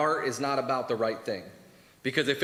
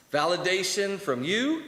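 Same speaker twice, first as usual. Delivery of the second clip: higher, slow rising "Validation from you?"; mock eager question; 6 semitones higher, slower than usual. Mocking his own hunger for approval, slow and rising. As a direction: draw it out as a hopeful question.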